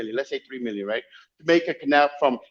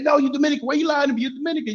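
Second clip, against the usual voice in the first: high insistent woman voice; 10 semitones higher, faster than usual. Retold or imitated. Guide insists he is Dominican.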